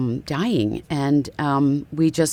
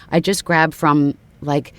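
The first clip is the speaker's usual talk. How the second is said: usual speaking voice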